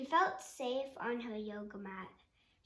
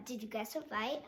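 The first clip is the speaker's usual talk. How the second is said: usual speaking voice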